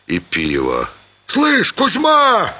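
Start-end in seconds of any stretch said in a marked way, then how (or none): 1.3-2.5 high-pitched, drawn-out call to a mate; tavern voices calling. A narrator's reading. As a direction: call across a noisy tavern. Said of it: "раздавались беспорядочно голоса". (громкий пьяный оклик в трактире)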